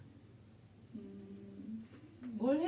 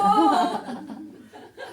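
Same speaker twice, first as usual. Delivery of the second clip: bursts into a high, loud laugh; laughing at her own grumbling; 8 semitones higher, 12 dB louder than usual. Real, not acted. Laughing at her own complaint to God, loud and high.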